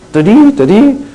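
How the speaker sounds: high, loud two-tone da-dee; sung two-tone siren. Retold or imitated. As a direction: mimic a two-note alarm tone. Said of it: "kind of tone".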